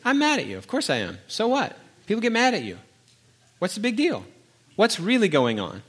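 firing back questions at his son; heated retort. Retold or imitated. Dad pressing his son, voice pitched high.

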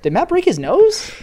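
high-pitched, laughing disbelief; mock surprise. Co-host feigning shock at the broken nose, mock surprise. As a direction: play astonished, voice leaping way up.

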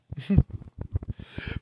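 breathy self-conscious laugh; embarrassed laugh. Real, not acted. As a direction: laugh at yourself. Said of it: "This might not be going as best".

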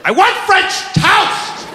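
high, slow, drawn-out demand; angry toddler. Acted. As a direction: demand it as a furious three-year-old. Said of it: "she's a little ball of anger".

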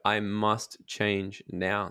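slow, firm resolve. Shown as a demonstration. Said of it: "get it to a point where it's like".

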